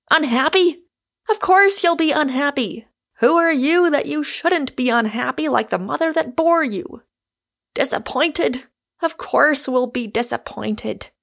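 loud, high rhetorical outburst; roaring rebuttal. A narrator's reading. Michael throwing Rosamond's fears back at her, roaring. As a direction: fling each objection back loudly. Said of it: "roared Michael".

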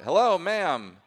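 loud call with falling hello. Real, not acted. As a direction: shout it down the line. Calling into silent phone.

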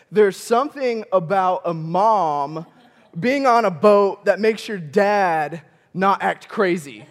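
drawn out, each word weighed; slow, knowing delivery; slow and deliberate. Shown as a demonstration. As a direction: let the point land slowly. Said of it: "repeats line slower, then faster".

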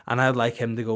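ordinary delivery

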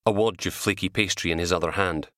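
plain reading voice

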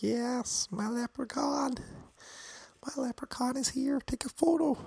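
very high put-on falsetto; gym manager impression. Gym manager hailing his Irish leprechaun, in a high mock voice.

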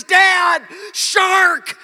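high shrieked warning, very loud; imitated delirious daughter. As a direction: scream it like a panicked kid spotting danger. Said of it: "you start getting delirious".

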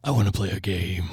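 Saw villain impression. Friend mocking a speaker's looks as the Saw villain, low creepy impression.

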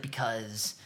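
low, soft quiet menace; hushed Joker. Retold or imitated. Retelling the Joker's pitch to the mob, quiet menace. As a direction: murmur it low like a villain.

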